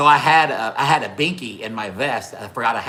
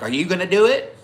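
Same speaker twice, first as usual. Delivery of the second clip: high, louder daring question; retold dare; 8 semitones higher, 5 dB louder than usual. Rider daring the man who threatened him, retold challenge. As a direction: throw the threat back, pitch climbing.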